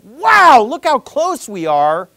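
loud, high, slowed-down rapturous exclamation; excited outburst. Retold or imitated. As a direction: cry it out with joyful wonder. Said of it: "something to get even more excited about".

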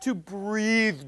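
slow, drawn-out mock lecturer voice; mimicked preacher teaching. Mocking a colleague defining a Hebrew word, slow exaggerated teacher voice. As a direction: draw out each word as if explaining to toddlers. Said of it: "and then he just says".

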